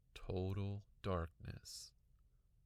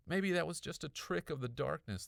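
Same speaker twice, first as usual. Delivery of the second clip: ordinary delivery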